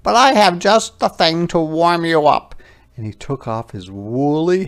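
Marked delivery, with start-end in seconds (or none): 0.0-2.6 a small creature deciding bravely; little hedgehog voice. Acted. Hedgehog offers his hat.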